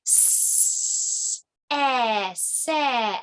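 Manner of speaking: S hissed, then eh, then se; phonics sounding out. Shown as a demonstration. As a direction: break se into its sounds. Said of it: "it likes to pretend it's letter S".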